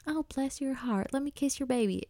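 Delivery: gently mock the sugary pageant-queen cliché. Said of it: "Not like the, like the ... type of pageant queen".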